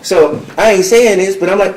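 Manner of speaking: ordinary talk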